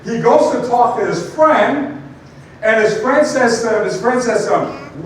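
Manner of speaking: ordinary talk, nothing marked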